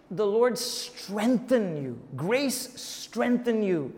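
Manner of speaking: "strengthen" stretched out slowly; slow, deliberate lean on "strengthen"; emphatic key word. Shown as a demonstration. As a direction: draw out "strengthen" for weight each time. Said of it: "It's emphasizing this idea".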